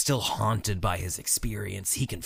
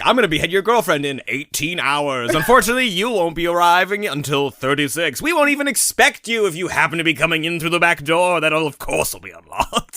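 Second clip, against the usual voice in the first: louder and higher, a hammed-up villain gloating over his plan; mock-grand evil mastermind spelling out the scheme, pitched up; gloating Bond villain; 7 semitones higher, 7 dB louder than usual. The villain taunts the buried hero, hammed-up and gloating. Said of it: "it's the James Bond moment".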